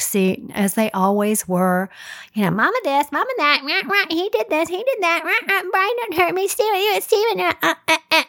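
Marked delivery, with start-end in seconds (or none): 2.5-8.2 put-on high, fast whiny kid voices; hammed-up squabbling children, much higher; bickering kids. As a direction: mimic squabbling kids tattling. Kids bickering in back seat.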